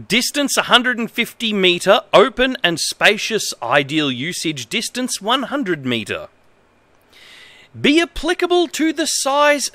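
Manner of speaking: mildly stilted reading of a badly translated manual; manual read-aloud. Streamer reading a bad manual to viewers, lightly formal. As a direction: read it out straight, a touch formal.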